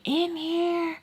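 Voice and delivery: high, drawn-out call through a door. Retold or imitated. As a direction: sing it out to whoever is knocking. Calling out to someone knocking.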